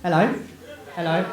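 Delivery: ordinary delivery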